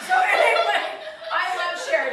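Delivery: loud, high, laughing outburst; loud exclamation. Real, not acted. Joking about losing a career, loud exclamation. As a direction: blurt it out loudly through amusement.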